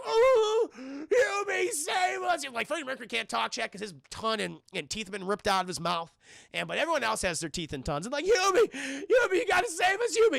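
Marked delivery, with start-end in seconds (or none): 0.0-2.4 high, wailing cry for help; mock crying plea. Tied-up townsfolk wailing to Hubie, mock crying. Victims begging from the stakes. 8.2-10.4 mock desperate plea. Townsfolk tied to stakes begging Hubie, high wailing plea.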